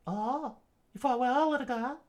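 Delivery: high falsetto character voice; Robin. Acted. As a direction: address the girl from up in falsetto. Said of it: "Robin said".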